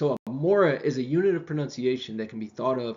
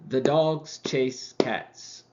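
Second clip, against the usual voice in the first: "The dogs chase cats" slow, with the beats on dogs, chase and cats; stress-timed rhythm; much slower than usual. Shown as a demonstration. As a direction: keep the same beat and let "The" slip in. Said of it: "It's the same rhythm".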